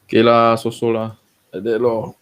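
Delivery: drawled Singlish with lah and lor; laid-back Singapore Creole English. Acted. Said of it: "We will talk in Singlish".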